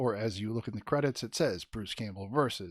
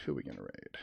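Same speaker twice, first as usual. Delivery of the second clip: "Who are we gonna raid?" dropped far lower and softer; low muttered repeat; 13 semitones lower, 8 dB quieter, faster than usual. Pretend.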